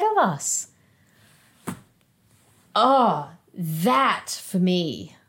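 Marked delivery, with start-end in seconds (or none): none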